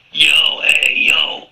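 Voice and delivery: loud, jokey American greeting; mock American yo. Voice message caller greeting the hosts, mock American shout. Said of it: "God, I went all American".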